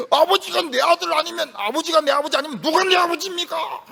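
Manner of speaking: put-on high, loud, indignant child shouting back; child's angry protest. 아들이 아버지에게 화내며 항의하듯 높고 크게. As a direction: play the hurt son yelling at his father. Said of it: "이러면 애가 막 화를 냅니다".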